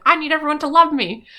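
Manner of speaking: hammed-up needy popular girl; needy Glinda. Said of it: "she's just like".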